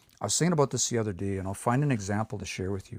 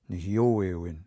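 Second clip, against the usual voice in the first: nehioewin, stressed on the middle o; stress moved further along; 3 semitones lower, slower than usual. Shown as a demonstration. Teacher shows the little change in nehioewin, stress on yo. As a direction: lean on yo after hi in nehioewin. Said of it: "You hear that little change?".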